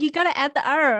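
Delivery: hammed-up irritated scolding; imitating annoyed Beijingers. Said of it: "they get quite pissed".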